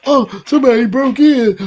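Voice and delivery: loud, high mock panic; hammed-up frightened cry, pitched well up. Man mocking himself panicking over a break-in, mock panic. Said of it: "'cause I can't be in there".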